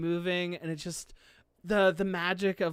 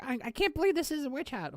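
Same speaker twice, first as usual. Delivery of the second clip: high-pitched incredulous mimicry; mock disbelief; 7 semitones higher, faster than usual. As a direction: mimic an astonished viewer in a higher voice. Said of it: "Some people are gonna be like".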